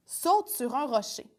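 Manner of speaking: ordinary delivery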